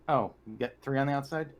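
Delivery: ordinary delivery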